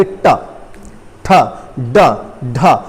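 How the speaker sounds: ट, ठ, ड, ढ said one by one with pauses; sounding out letters. Shown as a demonstration. Teacher demonstrating retroflex letters to students. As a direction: sound out ट, ठ, ड, ढ separately. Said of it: "जैसे ही जीभ हटेगी एकदम आवाज आएगी".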